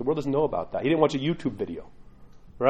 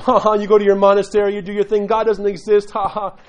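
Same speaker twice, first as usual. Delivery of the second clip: a mild mock jeer, louder, taunting the priest; mocking punk kids; 5 semitones higher, 6 dB louder than usual. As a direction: imitate kids taunting a priest with a fake laugh. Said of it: "And they are mocking him".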